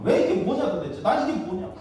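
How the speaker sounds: high, exasperated mock grumbling; resentful complaint. Israelites who followed the pillars now facing death, resentful. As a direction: act out a bewildered, aggrieved protest. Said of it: "원망하는 거예요".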